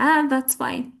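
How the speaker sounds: coolly dismissive acceptance; cold. Shown as a demonstration. Answering a friend who didn't call back, cold. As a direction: agree while clearly displeased. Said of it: "It's a cold, that's fine".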